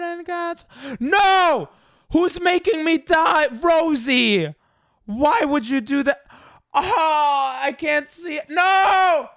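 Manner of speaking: high, loud distressed shouting; high, loud cries of defeat; frantic yelling. Real, not acted. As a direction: scream at the game as you die. Dying in game, blaming Rosie.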